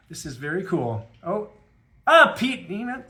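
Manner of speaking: usual speaking voice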